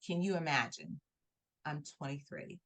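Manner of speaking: normal talking voice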